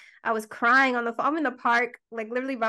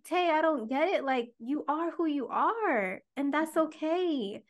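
calm her down like a mom would; gentle reassurance; 6 semitones higher than usual. Retold or imitated. Mother on the phone calmly reassuring her crying daughter.

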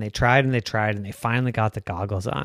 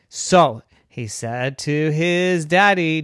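usual speaking voice

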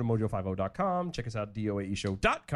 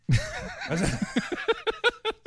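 high burst of laughter. Real, not acted. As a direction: break into a high, shrieking laugh. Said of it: "worst dad joke I've ever heard".

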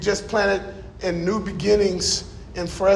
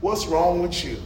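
much lower, soft voice from above; a quiet voice of God rebuking the pastor; God's voice; 6 semitones lower, 7 dB quieter than usual. Retold or imitated. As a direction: speak it low and hushed as God. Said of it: "I heard God speak to me".

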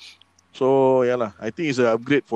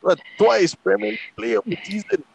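high, proud bragging; excited boast; 7 semitones higher, 3 dB louder than usual. Real, not acted. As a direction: hype up his record. Boasting of Bale's record.

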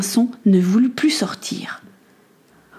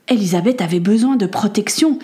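ordinary delivery

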